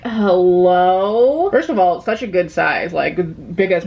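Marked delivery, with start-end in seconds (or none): 0.0-1.5 drawn-out hello. Real, not acted. Gushing over the print.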